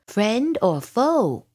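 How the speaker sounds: high, slow challenge; challenging dragon. A narrator's reading. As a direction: draw it out like a sentry's challenge. Dragon challenges unseen owl.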